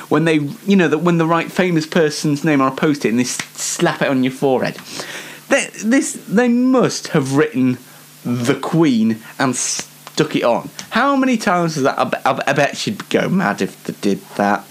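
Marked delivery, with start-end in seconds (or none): none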